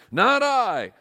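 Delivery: a pig brushing off work; lightly voiced pig refusing. Pig refusing to help the hen, loud and high.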